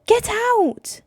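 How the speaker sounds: high, loud scream. Acted. Brother shrieking at sister to get out. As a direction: shriek her out of the room.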